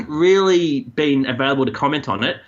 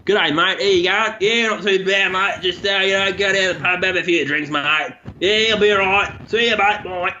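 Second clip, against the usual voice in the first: higher-pitched broad Australian Bogan talk; Bogan accent; 4 semitones higher than usual. Shown as a demonstration. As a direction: do a friendly Bogan saying hello to a mate. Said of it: "I can do really well is Bogan".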